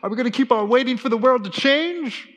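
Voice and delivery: loud, high hype-man voice; rally the crowd like an MC; fired-up MC. Retold or imitated. MC challenging the church crowd, loud and high. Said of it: "the M C said".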